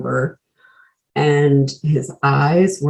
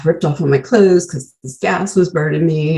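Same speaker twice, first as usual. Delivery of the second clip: ordinary delivery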